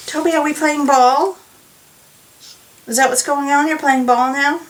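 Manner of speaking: high, playful voice for the dog; talking to the dog. Real, not acted. Knitter asks her dog Toby if he's playing, high playful coo.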